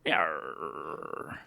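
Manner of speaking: low breathy pirate yarr; low pirate growl. Pretend. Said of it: "my old pirate voice".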